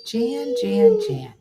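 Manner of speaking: very high-pitched mock whine; sitcom catchphrase mimicry. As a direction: squeal the name three times. Mimicking the Brady Bunch catchphrase.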